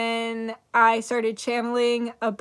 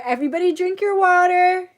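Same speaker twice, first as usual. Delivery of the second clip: high, loud call to everyone; 7 semitones higher, 7 dB louder than usual. Real, not acted. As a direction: sing it out to the whole crowd. Calls out to all viewers.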